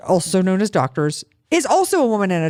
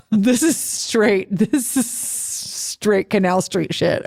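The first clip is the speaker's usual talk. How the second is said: slow, laughing through the words; much slower than usual. Real, not acted. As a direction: chuckle it out slowly. Laughing about knockoff bag.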